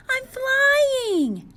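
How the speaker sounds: very high, loud joyful squeal; excited. Acted. Penguin floating up on a balloon, squealing with joy. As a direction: burst with delight at finally flying. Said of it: "said Flip Flop excitedly".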